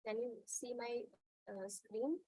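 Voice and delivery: very faint, far from the mic; barely audible. Real, not acted. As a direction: voice too low to hear clearly. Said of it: "your voice is very low".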